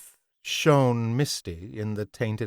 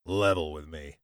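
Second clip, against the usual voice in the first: low, quiet menace; gruff man voice; 6 semitones lower, 5 dB quieter than usual. A narrator's reading. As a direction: lean in, quietly threatening. George's low voice.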